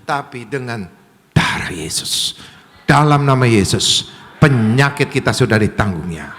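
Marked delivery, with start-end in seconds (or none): 1.3-6.3 full-force preaching. Real, not acted. Preacher declaring sickness healed in Jesus' name, loud forceful proclamation. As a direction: proclaim it with force, building to a rallying call.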